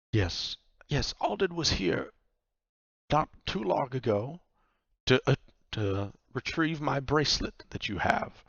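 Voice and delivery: lightly voiced woman making her point; pointed Vaku. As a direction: chime in pointedly, pausing between phrases. Vaku chimes in.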